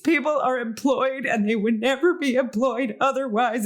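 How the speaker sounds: high, mock-tearful gushing; imitating crying. Customer overcome walking into the coffee shop, imitated crying. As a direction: act out being moved to tears. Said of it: "crying 'cause I'm me".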